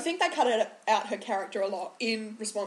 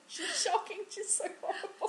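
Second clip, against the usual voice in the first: laughing; 9 semitones higher, 6 dB quieter than usual. Real, not acted. Laughing while criticising actress.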